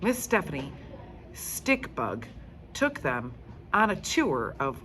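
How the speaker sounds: usual speaking voice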